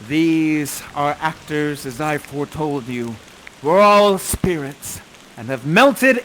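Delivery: solemn, swelling stage declamation; Prospero's farewell speech. Acted. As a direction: deliver it grandly, then let it fade.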